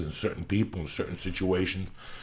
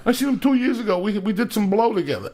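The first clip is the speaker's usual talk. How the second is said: higher, louder caricature of the whiny friend; mock fake mourner; 12 semitones higher, 5 dB louder than usual. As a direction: ham up the fake grief, pitched up. Mocking the fake mourner's answer.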